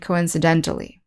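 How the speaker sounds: "coincidentally" stressed on DEN; co-in-ci-DEN-tally, closing the list. Shown as a demonstration. Teacher finishing the recap, stressing DEN in "coincidentally". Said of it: "the stress on the same syllable".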